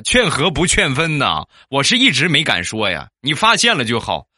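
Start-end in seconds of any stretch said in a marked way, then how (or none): none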